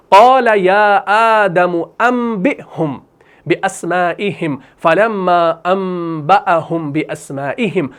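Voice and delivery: measured, slow recitation with drawn-out vowels; tajweed-style Arabic recitation; Quran recitation. Real, not acted. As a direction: recite the verse slowly, holding nasal M.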